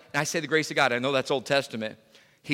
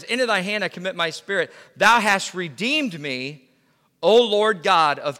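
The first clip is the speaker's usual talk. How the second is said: reads the verse slow and solemn; lingering over each word of the psalm; reverent, drawn-out recital; slow scripture reading; 4 semitones higher, 5 dB louder than usual. Acted. Reads scripture aloud, slowly.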